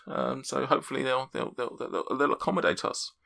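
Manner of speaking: prim diner talking up her favourite place; refined lady. Acted. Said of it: "I went a bit German towards the end".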